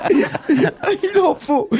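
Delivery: very high, drawn out through laughter; real helpless laughter; laughing. Real, not acted. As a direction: stretch it out while cracking up. Host cracking up at remark.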